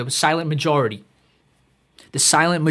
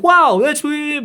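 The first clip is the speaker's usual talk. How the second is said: high, slow, hammed-up awe; mock amazement; 10 semitones higher, 5 dB louder, much slower than usual. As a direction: gasp it out like a dazzled fan. Mock audience amazed by cliché.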